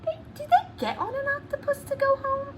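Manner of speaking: high, fast, puzzled; mock surprised question. Teacher feigning confusion to prompt children, surprised. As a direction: play dumb about the octopus. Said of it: "puzzled question to children".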